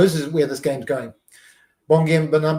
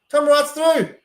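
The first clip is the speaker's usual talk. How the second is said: much higher, louder echo of the break call; frantic repeat; 10 semitones higher, 6 dB louder, slower than usual. Real, not acted. Fan repeating the call as the winger runs clear, frantic. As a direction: repeat it in rising panic.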